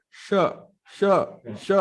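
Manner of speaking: "श" as sh, repeated in separate beats; tongue back for each "श"; SH sound demo. Shown as a demonstration. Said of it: "tongue backward जा रही है". (हर "श" जीभ पीछे करके)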